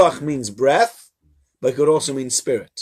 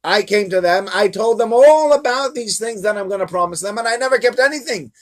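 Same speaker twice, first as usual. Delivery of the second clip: raised pitch, loud, mock-exasperated scolding; exasperated God; 10 semitones higher, 5 dB louder than usual. God scolds Moshe for complaining, high exasperated rant. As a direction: throw up your hands at the complainer. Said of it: "Rashi says".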